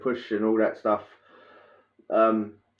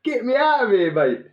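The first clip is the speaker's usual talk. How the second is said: mock desperate plea; 10 semitones higher, 4 dB louder than usual. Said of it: "I threw on the floor".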